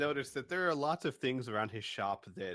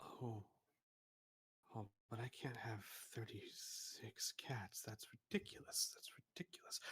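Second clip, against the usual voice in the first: unvoiced, much softer aside; whisper; 2 semitones lower, 15 dB quieter than usual. Shopkeeper whispering in dismay over the cat count. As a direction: hiss it to yourself in dismay.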